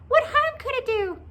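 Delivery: high, loud squeaky coaxing; squeaky pleading creature; urging little pigtail. A narrator's reading. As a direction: urge him on in a tiny high voice. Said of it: "urged the pigtail".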